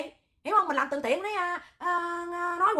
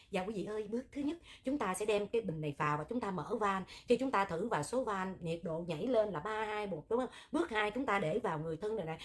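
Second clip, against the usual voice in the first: soft, lower, gentle announcer voice; a saintly, soothing guide voice, softer and lower; mock gentle Đại Nghĩa voice; 5 semitones lower, 8 dB quieter than usual. Mock soothing voice for oxygen steps, much softer. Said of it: "còn giọng Đại Nghĩa hay lắm".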